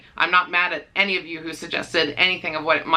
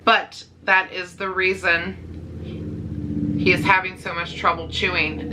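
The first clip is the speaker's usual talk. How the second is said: normal talking voice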